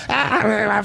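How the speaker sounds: ordinary talk, nothing marked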